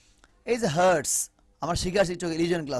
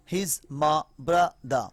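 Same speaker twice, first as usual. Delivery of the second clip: slow syllable demo; much slower than usual. Shown as a demonstration. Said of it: "আরও short করা যায়".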